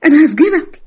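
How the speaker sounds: high, tearful outburst; distraught threat. Acted. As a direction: threaten him through tears. Victim threatens her assailant.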